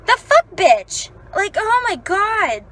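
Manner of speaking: high, slow, exasperated outburst; retold angry venting. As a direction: vent at someone who isn't there, drawing it out. Venting angrily at the retold boss.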